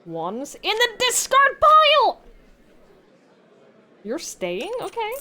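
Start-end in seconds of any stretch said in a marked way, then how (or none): 0.0-2.2 loud, higher call-out of the card; excited announcement. Real, not acted. Player calls out the card drawn, loud and excited.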